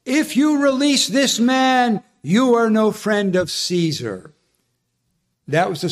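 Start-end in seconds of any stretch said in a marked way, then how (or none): 0.1-4.3 higher, slow, pointed threat; threatening leaders. Retold or imitated. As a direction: lean on each word as a threat. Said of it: "this was their trump card".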